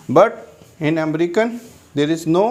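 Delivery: normal talking voice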